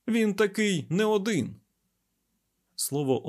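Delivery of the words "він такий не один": high, emphatic exclamation; heated, raised-pitch declaration; exasperated. A narrator's reading. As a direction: insist on it, losing patience. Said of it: "не витримав Айзек".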